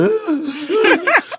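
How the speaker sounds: a sudden high squeal of laughter. Real, not acted. Laughing high while telling the reality show story. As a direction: burst out in a shrill-pitched laugh.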